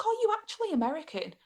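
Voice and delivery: high amazed question; surprised. Retold or imitated. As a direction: ask it in disbelief.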